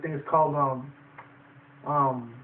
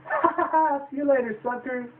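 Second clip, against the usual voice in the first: much higher hammed-up taunting cackle; mock gloating laugh; 10 semitones higher than usual. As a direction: laugh it off while escaping. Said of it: "He's like".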